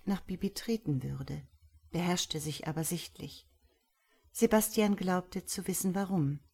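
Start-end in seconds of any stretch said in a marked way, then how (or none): none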